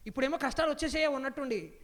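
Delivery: ordinary talk, nothing marked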